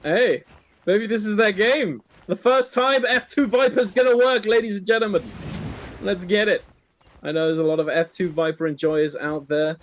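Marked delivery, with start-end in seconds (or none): 0.0-6.6 rally the audience; hype announcer. Real, not acted. Hyping up the F2 Viper play.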